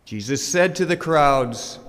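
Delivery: normal talking voice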